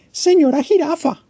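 put-on high, small childlike bird voice; a tiny chick asking hopefully; little bird Choco. (pajarito Choco, voz aguda e infantil)

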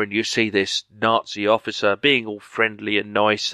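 ordinary talk

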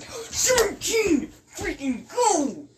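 drawn-out high cheer, then a laugh; excited cheer. Real, not acted. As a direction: cheer the roll, stretching it out. Cheering the dice roll.

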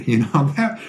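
usual speaking voice

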